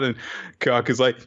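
usual speaking voice

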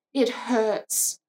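ordinary talk

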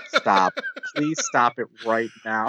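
laughing plea. Retold or imitated. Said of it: "I was like".

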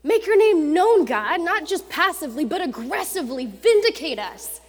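loud, high, urgent plea to God; Isaiah crying out to God, loud and high; pleading Isaiah. Acted. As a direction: demand God shake the world, rising to a cry. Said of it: "So Isaiah's like".

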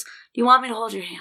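quick unvoiced whisper. Husband whispering an offer at the door. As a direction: whisper it fast and close. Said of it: "Big D, he goes".

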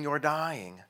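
ordinary talk, nothing marked

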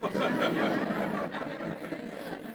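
breathy high laugh. Real, not acted. Pastor laughing at his own wife story. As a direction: laugh at your own joke.

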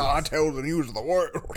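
slow Southern drawl; slow, put-on Southern newsreader; mock Southern impression. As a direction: drag it out in a mock Southern accent. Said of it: "He's like".

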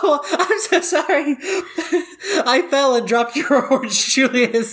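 high, laughing fake sorry voice; overdone apology through giggles; mock apology.

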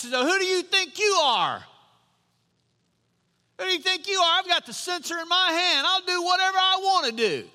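high-pitched indignant outburst; acting out a proud man's angry defiance; mad. Proud king defying the priests who oppose him, mad. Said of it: "he gets mad".

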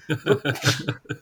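一串止不住的魔性大笑; 被说中后放声怪笑; 魔性笑声. Real, not acted. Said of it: "听到Roy魔性的笑声了". (真的笑出魔性的笑声)

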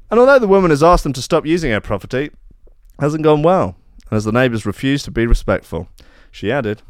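ordinary talk

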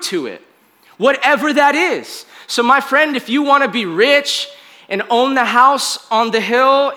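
high, forceful preaching voice; impassioned preaching. Real, not acted. Preacher urges the congregation to lean in and listen to God, impassioned.

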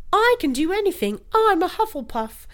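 put-on high, bright girl voice from a sketch; man doing a woman's voice. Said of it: "that girl from that sketch that's like".

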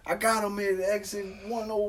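high, lightly put-on cop radio voice; mock cop on radio. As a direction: play the trooper calling it in. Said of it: "on the radio talking that police code talk".

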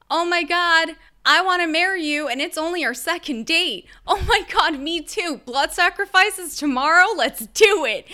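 loud, high, over-the-top excitement; hammed-up gushing. Two anxious people smitten on a second date, hammed-up gushing. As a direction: gush loudly like you're instantly smitten.

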